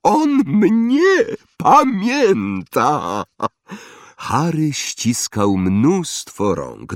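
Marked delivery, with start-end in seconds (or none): 0.0-3.2 very high, drawn-out boast; soaring, slow exclamation of joy; proud delighted cry. A narrator's reading. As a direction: crow it to the whole room. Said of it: "krzyknął Dedalus Diggle, rozglądając się z dumą".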